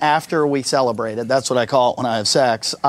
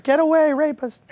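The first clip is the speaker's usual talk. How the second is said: high, breathy mock valley girl; mock supermodel; 11 semitones higher than usual. Comedian mimicking the supermodel gushing, high mock girl voice. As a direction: gush like a vapid model. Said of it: "she was like".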